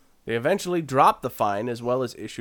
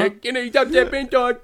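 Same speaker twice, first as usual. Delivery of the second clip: high, loud hammed-up Cockney sing-song; mock Cockney; 11 semitones higher, 5 dB louder than usual. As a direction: ham it up like a bad Cockney chimney sweep. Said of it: "There's that accent".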